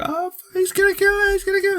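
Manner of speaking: very high squealing whimper; terrified kid. Retold or imitated. Andy pulled out from under the bed, squealing in fear. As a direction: whimper it like a scared little boy. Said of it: "the kid's like".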